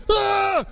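a sudden high cry when licked; high yelp of shock. Retold or imitated. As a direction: yelp like you've been startled. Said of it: "And the guy goes".

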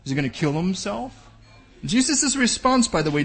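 normal talking voice

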